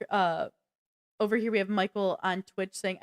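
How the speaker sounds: ordinary talk, nothing marked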